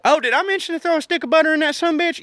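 high, quick burst of excitement; sudden excited reminder. Real, not acted. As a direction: blurt it out like you just remembered. Sudden remembered tip, excited.

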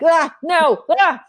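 high, loud caught-off-guard ah no; flustered dodge. Retold or imitated. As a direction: sound caught out by the question. Flustered reply to therapist.

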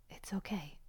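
hushed reassurance to the inner critic; calm it down in a whisper; soothing whisper. Retold or imitated. Soothing her anxious inner critic, gentle whisper. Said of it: "You're like".

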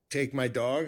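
ordinary talk, nothing marked

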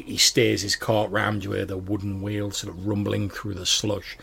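ordinary delivery

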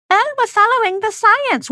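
mock voice. Mocking believers' phrase.

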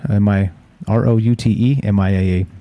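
usual speaking voice

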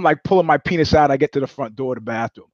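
ordinary talk, nothing marked

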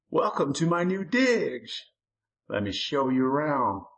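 high, slow taunting monster voice. A narrator's reading. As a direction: sneer the welcome like a host from hell. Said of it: "Lurking taunted Jimmy".

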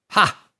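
short high scornful "Ha"; gloating laugh. Acted. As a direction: bark out a smug laugh.

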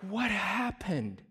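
high, drawn-out question; retold bafflement. Pastor asks about the suddenly calm boy, retold baffled question. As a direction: stretch it out in mock disbelief.

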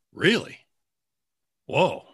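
a touch of hammed-up astonishment; retold surprise. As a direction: relive being caught off guard. Teller recalls his own amazement.

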